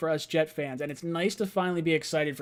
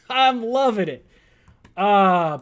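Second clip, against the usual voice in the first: usual speaking voice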